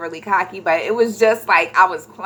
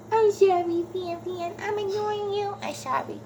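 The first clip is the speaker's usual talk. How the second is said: much higher, softer little voice; high cooing aside; 11 semitones higher, 7 dB quieter than usual. Real, not acted. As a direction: apologize in a tiny high-pitched coo. Cooing aside to someone called Pam.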